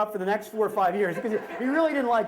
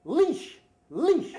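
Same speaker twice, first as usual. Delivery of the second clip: high, loud imitation of her yell; retold yell; 9 semitones higher, 5 dB louder than usual. Woman yells at dog owner across the park, mock yell. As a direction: yell it like the indignant passer-by. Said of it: "and she yells".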